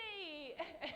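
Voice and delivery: high giggle. Real, not acted. Laughing after the quote.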